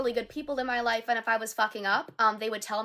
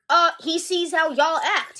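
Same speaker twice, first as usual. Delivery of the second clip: higher, louder, slow and deliberate; pointed retort; 6 semitones higher, 8 dB louder, slower than usual. Real, not acted. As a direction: spell it out for every man listening.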